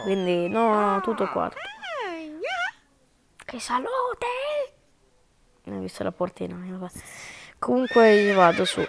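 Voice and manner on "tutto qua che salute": silly slowed squeak. Pretend.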